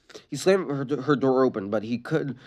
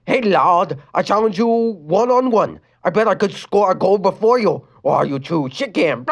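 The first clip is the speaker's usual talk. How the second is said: put-on German accent; 5 semitones higher, 4 dB louder than usual. A narrator's reading. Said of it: "said in a German accent".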